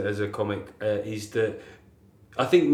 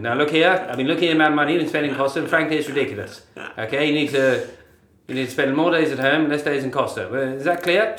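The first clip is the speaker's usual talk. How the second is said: 1950s patriarch voice; 3 semitones higher, 5 dB louder, faster than usual. Shown as a demonstration. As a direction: lay down the law, old movie style. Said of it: "the weirdness of the voice".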